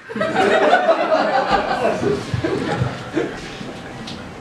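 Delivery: high breathless laugh; big laugh. Real, not acted. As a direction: laugh at your own bit. Comic laughing.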